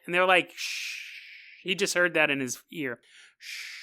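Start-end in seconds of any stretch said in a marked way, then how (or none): 0.5-1.0 long soft shush. Acted. Aliens shush Ralph in his ear. 3.4-3.8 long, very soft hush; shushing. Acted. Repeats the shush in his ear.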